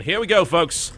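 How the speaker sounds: big ring-announcer build-up; boxing ring announcer. Acted. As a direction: introduce the fighters like a boxing MC.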